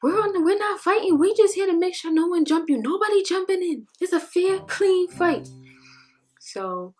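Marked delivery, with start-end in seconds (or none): 0.0-5.5 higher, bit louder quoted friends; a hint of hyped-up friends egging her on; friends' reassurance. Friend telling her the fight is fair, mildly hyped.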